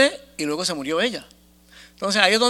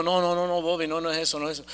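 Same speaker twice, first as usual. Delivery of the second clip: low, soft retold protest; calming refusal; 4 semitones lower, 8 dB quieter, faster than usual. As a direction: quietly wave off an excited friend. Se opone al socio entusiasmado. (negativa en voz baja, imitada)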